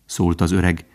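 ordinary delivery

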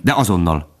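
ordinary delivery

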